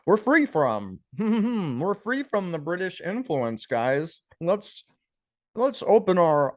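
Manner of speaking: high, slow sarcastic pitch; mock propaganda. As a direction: parody a cheerful official announcement. Mocking pro-Russian propaganda.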